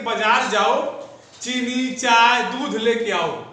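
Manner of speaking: brief stop after चीनी and after चाय; pauses between list items. Shown as a demonstration. Teacher showing where commas go in a sentence, pausing at each item. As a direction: set चीनी, चाय, दूध apart, drawn out slowly. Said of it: "तो हर जगह रुकना".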